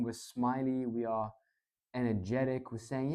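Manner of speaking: ordinary talk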